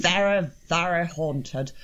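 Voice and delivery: slow, hammed-up fake posh English accent of a drunk uncle; mock a bad fake English accent, drawn out. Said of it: "the accents were so terrible".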